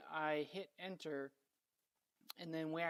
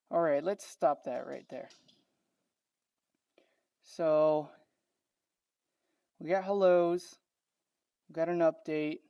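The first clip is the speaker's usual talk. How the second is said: talking through a face mask; muffled, mask over mouth; 11 dB louder than usual. Real, not acted. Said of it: "let me take my mask off".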